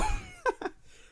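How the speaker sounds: sudden high squeal of laughter; shrieking laugh. Real, not acted. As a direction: burst into a shrill disbelieving laugh. Laughing at player stats.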